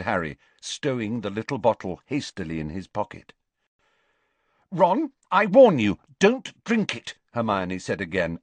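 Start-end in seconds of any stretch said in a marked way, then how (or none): 4.7-7.2 high, much louder urgent warning; alarmed warning. A narrator's reading. Hermione warning Ron off the juice, alarmed. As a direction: stop him at all costs.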